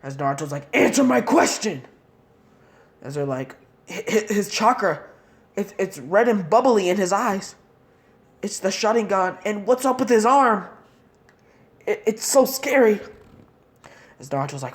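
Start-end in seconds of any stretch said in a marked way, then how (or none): 0.8-1.8 high, loud command; angry demand. Acted. Naruto demanding the enemy ninja answer him, angry shout. 3.9-13.0 high, stuttering terror; frightened stammer. Acted. Enemy ninja cowering before Naruto's red chakra, high scared stammer.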